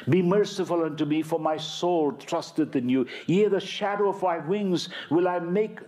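fervent, quickened psalm plea; intensified plea. Acted. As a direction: beg it again with urgency.

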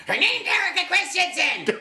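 high, loud imitation of his wife; man doing a woman's voice. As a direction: squeal it like an annoyed wife. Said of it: "That's actually a really good impression of my wife".

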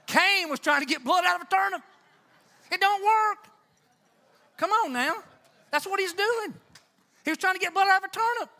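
loud, high, fast preaching shout; a rousing, shouted climax from the pulpit; full-force preaching. Acted.

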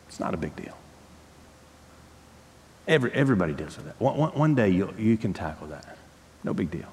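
low, soft mock shrug-off; dismissive excuse-making. Preacher voicing someone excusing lust, dismissive. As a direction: toss it away quietly, pretending it hardly matters.

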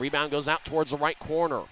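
usual speaking voice

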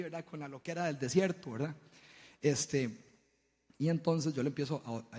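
ordinary talk

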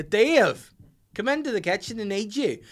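high, loud mock Ballymena man calling. Wife calling him in, mock Ballymena man voice. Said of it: "a man from Ballymena".

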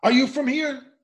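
high, loud incredulous shot back; mocking retort. Young man turning the question on the reporter, high and loud. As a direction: fire the question back in disbelief. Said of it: "And the young man said".

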